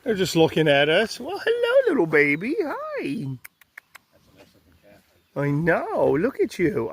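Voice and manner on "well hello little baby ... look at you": put-on much higher cooing baby talk. Greeting a calf in the field, high sing-song baby talk. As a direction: coo at the calf like at an infant.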